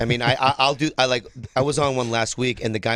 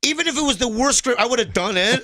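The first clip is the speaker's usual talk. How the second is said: much higher and louder, half laughing; high, loud joking; 13 semitones higher, 4 dB louder than usual. Real, not acted. Guest jokes to the host about bad scripts, high and laughing. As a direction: tease himself for taking any script.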